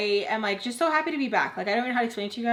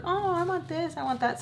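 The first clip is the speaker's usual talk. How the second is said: put-on little kids; 8 semitones higher than usual. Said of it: "The little ones are like".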